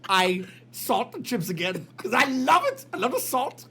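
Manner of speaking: put-on a light Indian accent, gleeful by the end; doctor confessing, a touch of Indian accent. Doctor admits to salting the chips, in a light Indian accent. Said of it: "Your impression of Indian guys".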